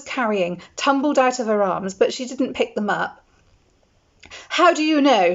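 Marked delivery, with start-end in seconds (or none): 4.5-5.3 spluttering. A narrator's reading. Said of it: "she spluttered".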